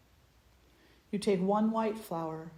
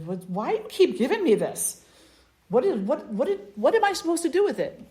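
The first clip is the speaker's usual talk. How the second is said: rising, louder, faster confused questions; bewildered; 6 semitones higher, 6 dB louder than usual. Acted. Loved one baffled by daily flowers, confused rising questions. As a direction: sputter it out, baffled.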